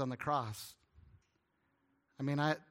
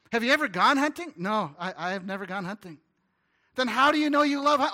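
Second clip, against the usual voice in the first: incredulous; 6 semitones higher, 9 dB louder than usual. Retold or imitated. Mock incredulous questioning.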